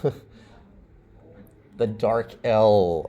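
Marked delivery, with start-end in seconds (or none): 0.0-0.1 "L" as a very short, light luh; syllable-initial L. Shown as a demonstration. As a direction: flick out a quick clear luh for "L". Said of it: "L at the beginning of a syllable".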